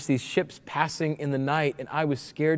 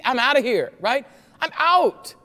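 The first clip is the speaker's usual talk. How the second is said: high, emphatic comic exit line; mock bailing out; 5 semitones higher, 3 dB louder than usual. Preacher playing an Israelite refusing to stand still, comic panic. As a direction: announce a getaway, voice jumping up.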